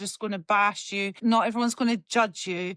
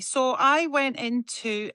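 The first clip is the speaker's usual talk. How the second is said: ordinary talk, nothing marked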